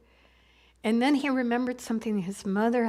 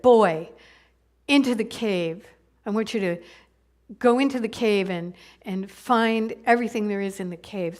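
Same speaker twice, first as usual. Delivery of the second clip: loud, commanding uncle's order; a stern uncle sends the boy into the dark cave, loud; 7 dB louder than usual. Acted.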